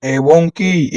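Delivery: ordinary delivery, nothing marked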